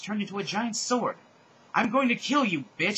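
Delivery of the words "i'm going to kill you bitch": high, loud storybook threat; mock-menacing raised voice; menacing scream. A narrator's reading. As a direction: yell it as a hammed-up villain. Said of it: "she screamed menacingly".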